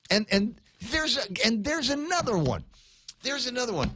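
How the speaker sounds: high, excited pointing out; eager, high voice spotting it. Real, not acted. Host spotting a cop car on air, excited.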